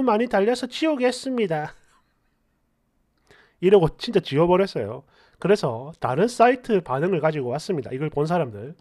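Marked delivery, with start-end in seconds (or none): none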